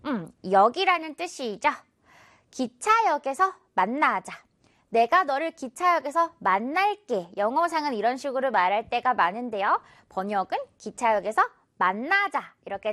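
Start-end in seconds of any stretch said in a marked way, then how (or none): none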